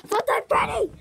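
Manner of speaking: high, loud desperate yell; calling out. Pretend. Stranded character calling for Funtime Freddy, loud high shout. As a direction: shout the name as loud as possible. Calling for companion after fall.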